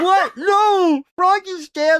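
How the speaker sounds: high, loud horrified cry; mock horrified friend. Friend hearing Froggy died, with a high, loud mock-horrified cry. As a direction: shriek it like a shocked friend in hammed-up panic. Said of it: "He's like".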